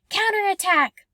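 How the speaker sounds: high, loud pretend war cry; battle shout. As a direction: yell back from the other side. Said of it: "an opposing shout".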